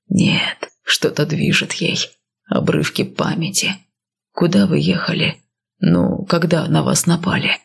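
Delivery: low, grim man's voice; gruff warrior, low and steady; stern northerner. Acted. Male lead voiced lower.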